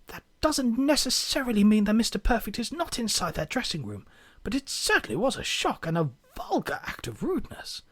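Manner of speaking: hushed, soft documentary-narrator voice; David Attenborough. Acted. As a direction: half-whisper it like a wildlife presenter. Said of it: "his best David Attenborough impression as he whispers".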